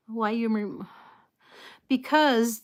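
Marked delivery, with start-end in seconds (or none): none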